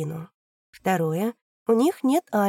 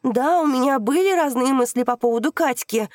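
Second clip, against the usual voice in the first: loud admission; 6 semitones higher, 6 dB louder than usual. Acted. Daughter keeps shouting.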